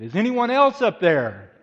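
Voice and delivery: very loud, high call for someone else; desperate yell. Acted. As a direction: yell hopefully past the first voice for help. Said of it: "in desperation he yelled".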